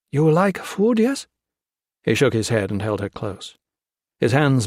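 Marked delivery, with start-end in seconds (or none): 0.1-1.3 Kase's voice. Acted. Kase voiced in broken English.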